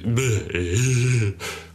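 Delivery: breaking into a real laugh mid-line. Real, not acted. Storyteller cracking up mid-scene, real laugh. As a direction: laugh at your own story.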